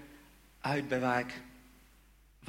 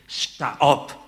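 much louder, slow rising command; commanding call; 17 dB louder, much slower than usual. Retold or imitated. Inner voice in the garden commands Patrick to rise, loud slow call. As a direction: call him to stand up, forcefully.

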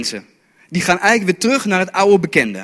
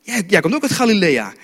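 high-pitched acted accusation; incredulous crowd member, acted high; challenging bystanders; 7 semitones higher than usual. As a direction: call him out, voice pitched up. Voices bystanders challenging Peter's accent.

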